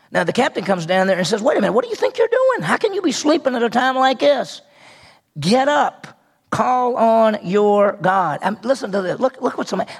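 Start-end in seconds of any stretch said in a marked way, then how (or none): 1.4-4.6 higher-pitched, incredulous scolding; indignant captain. Acted. Captain rousing sleeping Jonah in a storm, indignant. 5.4-8.4 word by word, very slow order; slow emphatic command. Acted. Captain ordering sleeping Jonah to pray, slow and emphatic.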